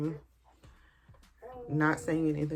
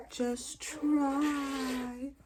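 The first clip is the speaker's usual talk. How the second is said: high, softer mock nurse echo; mimicking the nurse again, high and softer; 9 semitones higher, 4 dB quieter than usual. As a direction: repeat her line mockingly, quieter. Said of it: "I never forget that".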